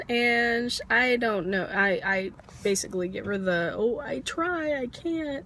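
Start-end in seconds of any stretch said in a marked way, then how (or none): 3.7-5.4 mimed helpless reply. Retold or imitated. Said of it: "miming of the mouth".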